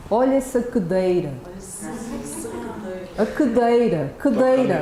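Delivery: cadeira with reduced vowels, Portugal style; Brazilian Portuguese vowels. Shown as a demonstration. Teacher showing reduced vowels in Portuguese. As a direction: say "cadeira" as "kudeira", the first vowel swallowed. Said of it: "For example".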